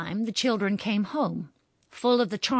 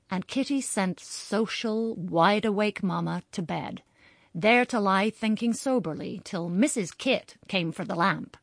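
usual speaking voice

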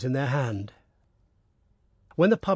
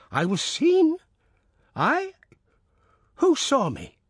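put-on shocked old peasant; 12 semitones higher, slower than usual.

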